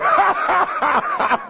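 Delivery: high laugh, no words. Real, not acted. Speaker laughing after boasting he fears nothing, high laugh.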